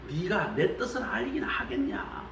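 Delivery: whispered, pointed question. Acted. Preacher voicing God questioning Job, in a whisper. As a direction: lean in and hush it.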